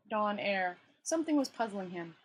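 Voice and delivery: ordinary delivery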